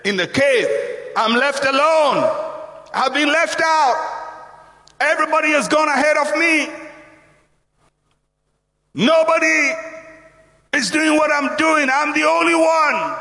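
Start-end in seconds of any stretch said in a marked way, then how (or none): 1.2-13.2 high, insistent wail of grievance; isolated, self-pitying. Acted. Elijah feeling isolated in the cave, self-pitying protest. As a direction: cry out each complaint pitched up. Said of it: "he's feeling isolated".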